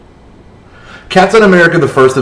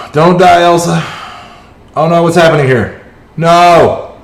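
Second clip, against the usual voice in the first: high, louder panicked pleading; 6 semitones higher, 3 dB louder than usual. Real, not acted. Gamer watching his team collapse, panicked. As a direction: beg the character not to die. Team about to die in battle.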